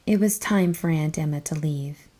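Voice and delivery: usual speaking voice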